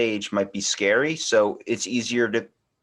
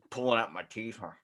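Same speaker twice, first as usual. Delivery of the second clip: normal talking voice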